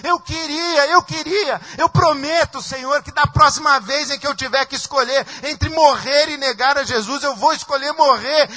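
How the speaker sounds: high pleading voice, on the edge of tears; imagined weeping. Acted. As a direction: beg forgiveness through acted sobs. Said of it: "E eu imagino Pedro chorando".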